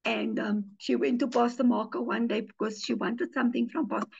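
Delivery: ordinary talk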